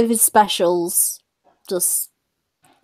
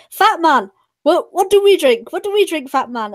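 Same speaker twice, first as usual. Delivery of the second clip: mock heckling; 6 semitones higher than usual. German heckler taunting Fluffy from the front. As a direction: taunt the comedian, higher. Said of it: "like he was being heckled".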